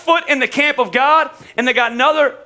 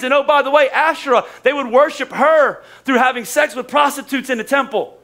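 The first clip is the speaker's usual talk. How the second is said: usual speaking voice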